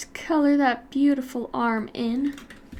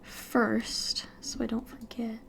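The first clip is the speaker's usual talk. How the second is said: whisper; 8 dB quieter, slower than usual. Real, not acted. Whispered aside while drawing.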